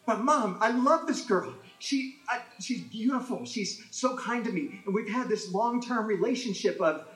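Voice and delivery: high, whiny plea; pleading son. Retold or imitated. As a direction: beg your mother to approve the girl, pitched high. Young Jimmy pleading to mom.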